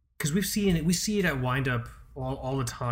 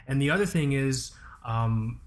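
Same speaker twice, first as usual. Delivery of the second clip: ordinary talk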